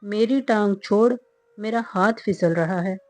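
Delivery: plain reading voice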